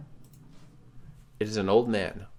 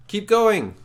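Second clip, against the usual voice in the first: loud, high rallying call; urgent shout; 11 semitones higher, 7 dB louder than usual. Acted. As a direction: yell them onward through the crowd. Urging the player on.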